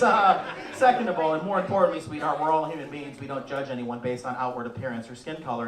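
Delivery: normal talking voice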